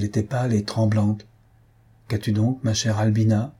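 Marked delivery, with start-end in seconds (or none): none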